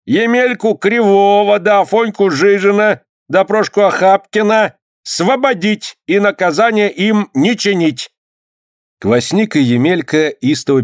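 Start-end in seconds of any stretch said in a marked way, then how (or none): 0.0-8.1 high, measured roll-call of names; clerk reading out. A narrator's reading. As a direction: proclaim the verdict formally, stretching the endings. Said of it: "зачитал дьяк".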